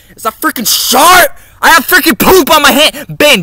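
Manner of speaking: high, loud disgusted screech; mock shriek. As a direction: screech at the mess on your hand. Sudden gross shock.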